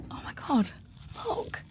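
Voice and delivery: thrilled whisper; excited whisper. Real, not acted. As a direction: keep it secret but giddy. Hushed in a near-empty cinema.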